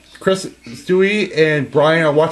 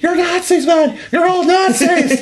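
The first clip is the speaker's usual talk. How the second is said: higher, shouted cartoon meltdown; hammed-up high-pitched rant; mock yelling; 4 dB louder than usual. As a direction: yell it like a frantic addict being dragged off. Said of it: "He starts yelling".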